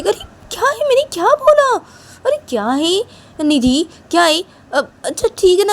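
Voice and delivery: very high, whiny objection; acted high-pitched exasperation; flustered protest. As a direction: protest in a squeaky put-on voice. Flustered protest when accused.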